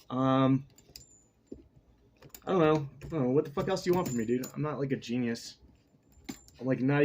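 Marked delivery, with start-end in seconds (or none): none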